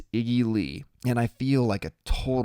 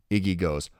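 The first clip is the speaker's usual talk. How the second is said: usual speaking voice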